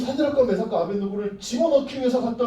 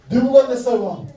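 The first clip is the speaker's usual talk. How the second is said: louder, slow proclaiming call; herald's call; 3 semitones higher, 7 dB louder than usual. Acted. Herald ordering crowds to bow, proclaiming loudly. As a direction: announce it like a royal herald.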